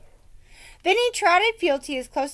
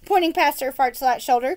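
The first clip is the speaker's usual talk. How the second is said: ordinary delivery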